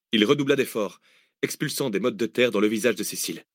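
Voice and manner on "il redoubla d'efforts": ordinary delivery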